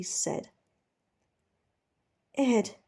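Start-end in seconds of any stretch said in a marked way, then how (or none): none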